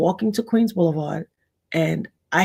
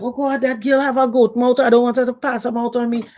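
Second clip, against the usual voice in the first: higher, worried aunties gossiping; fearful neighbor voice; 5 semitones higher than usual. Retold or imitated. Neighbors fearing the girl's curse, worried raised voice. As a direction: fret like a superstitious neighbor.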